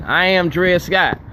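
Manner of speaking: slow, weighty, each word set down; slow declaration. Real, not acted. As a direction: proclaim it like a verdict. Same slogan repeated.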